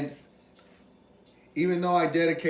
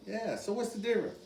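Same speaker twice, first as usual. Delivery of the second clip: ordinary talk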